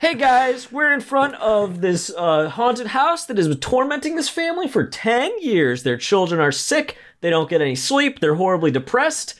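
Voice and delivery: high, showy TV presenter voice; hammy paranormal show host; ghost hunter host voice. Retold or imitated. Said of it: "He's like".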